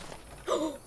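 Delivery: high, quick gasp; startled gasp. Real, not acted. Player scared by horror game, startled gasp. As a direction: catch your breath in fright.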